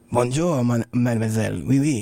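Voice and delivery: lightly put-on French accent; amateur American attempt at a French accent. Shown as a demonstration. Reluctant guy showing friends his French, French accent. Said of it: "say it in a French accent".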